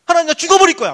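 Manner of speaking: loud, high cry to God; desperate prayer. Acted. Young Joseph praying to God, desperate. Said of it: "막 이러면서".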